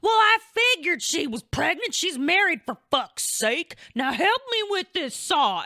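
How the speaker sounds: high, loud, mock grumbling old dad; imitating dad grumbling; old dad brushes off the news; gruff dad. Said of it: "He's like".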